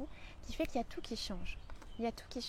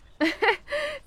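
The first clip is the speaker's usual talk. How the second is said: sudden high burst of laughter; real laugh, high and loud; 12 semitones higher, 7 dB louder than usual. Real, not acted. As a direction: laugh out loud at her own joke. Laughs at her own joke.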